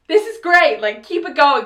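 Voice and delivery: loud, high retold enthusiasm; excited cheering on. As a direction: urge the performers on happily.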